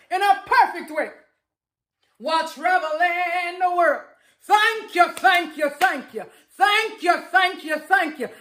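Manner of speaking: ecstatic, laughing affirmation chant; fervent affirmation. Shown as a demonstration. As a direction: shout your thanks to the universe. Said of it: "So I'm gonna say this one more time for you".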